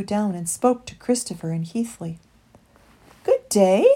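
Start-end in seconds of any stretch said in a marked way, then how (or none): 3.2-3.9 loud coo with a rising lilt; lilting, birdlike hello; cooing dove. A narrator's reading. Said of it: "she cooed".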